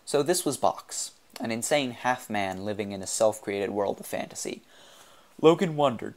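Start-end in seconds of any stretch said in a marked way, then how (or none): none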